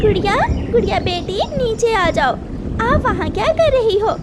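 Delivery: high, fast call to her daughter; mother calling her daughter down. Acted. Mother calls daughter on roof.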